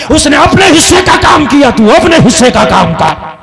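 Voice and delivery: loud exhortation. Acted. Preacher urges loudly.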